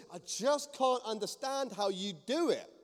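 slow, high, loud exasperation; imitating someone who just can't get it; worked-up bafflement. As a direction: drag it out in baffled protest. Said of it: "getting worked up".

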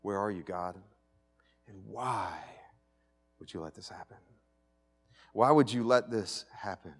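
hushed, broken questioning; anguished lament. Retold or imitated. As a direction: plead with God in despair. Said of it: "wrestling with God".

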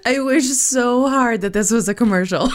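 giggling wish; laughing. Real, not acted. Host laughing at her wish for a sponsor.

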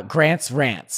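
"Grant's Rants" slowly, with the a of "cat"; slow demonstration of "Grant's Rants", front American vowels; American model pronunciation. Shown as a demonstration. Guest demonstrates his title for host, slow American "Grant's Rants". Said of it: "How do you say it?".

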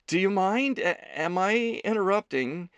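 hesitant, stumbling question; unsure if he's welcome; uncertainly. A narrator's reading. Leo asks Ethan if he is interrupting, hesitant. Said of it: "he asked uncertainly".

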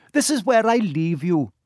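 usual speaking voice

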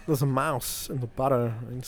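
deliberate film star voice; Christopher Walken. Retold or imitated. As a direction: pause oddly through the line. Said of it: "everybody always quotes is like".

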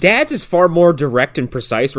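ordinary talk, nothing marked

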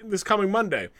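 ordinary talk, nothing marked

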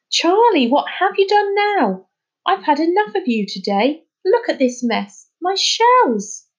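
exasperated teacher. A narrator's reading. Teacher scolding Charlie over her shells, exasperated. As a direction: scold him, fed up.

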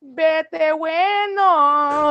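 very slow, loud mock sendoff; mock dramatic mom. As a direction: stretch it out like a theatrical Dominican mother. Mother leaving, Spanish quote.

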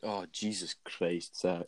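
low, slow dismayed sigh; disappointed groan. Real, not acted. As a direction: sound let down and exasperated. Dismayed at lasagna choice.

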